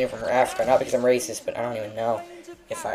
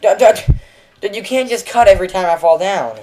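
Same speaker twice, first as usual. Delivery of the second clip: mocking dimwit taunt; 6 semitones higher, 6 dB louder than usual. Mocking the game director.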